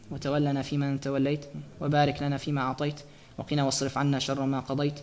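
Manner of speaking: rapid, hushed Arabic supplication; closing prayer. Acted. Teacher closes the lesson with a dua for everyone, fast and soft. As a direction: rattle off the dua quickly under the breath.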